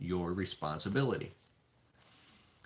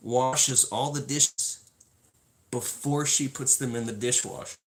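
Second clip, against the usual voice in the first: usual speaking voice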